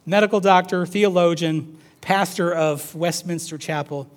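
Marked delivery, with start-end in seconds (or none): none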